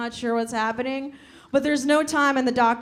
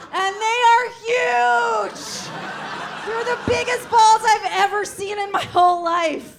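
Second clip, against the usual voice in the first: amazed; 8 semitones higher than usual. Real, not acted. Awed reaction to balls.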